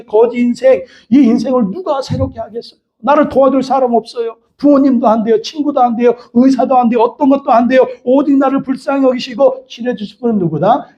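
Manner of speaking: normal talking voice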